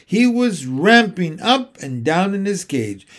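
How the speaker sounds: usual speaking voice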